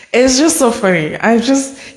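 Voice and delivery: ordinary delivery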